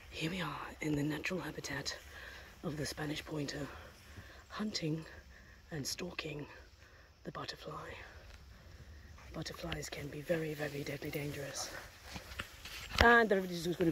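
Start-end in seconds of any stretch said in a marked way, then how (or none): 0.1-11.7 hushed wildlife presenter narrating a hunt; nature documentary whisper. Acted. To viewers, about the dog stalking butterflies, hushed documentary whisper. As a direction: whisper it like a nature film, low and soft.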